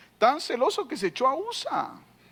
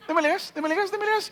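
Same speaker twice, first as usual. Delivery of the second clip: much higher, teasing "démele gas"; mock dismissive king; 8 semitones higher, faster than usual. Preacher mocking an uncaring king, higher and teasing. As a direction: toss it off with mockery.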